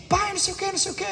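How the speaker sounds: lightly voiced child with news; chattering child. Children come telling things to their father, lightly voiced chatter. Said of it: "os nossos filhos chegam contando coisas pra gente".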